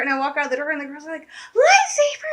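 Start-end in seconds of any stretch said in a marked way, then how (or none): 1.3-2.3 very high, drawn-out loud squeal; freaking-out young fans. Retold or imitated. Girls hearing of the lightsaber skirt, mimicked shriek. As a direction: shriek it slowly like an overjoyed little fan. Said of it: "they're, like, freaking out".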